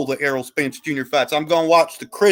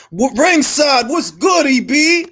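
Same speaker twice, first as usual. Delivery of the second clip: calling out; 8 semitones higher, 3 dB louder, slower than usual. Real, not acted. Calling out to Ringside.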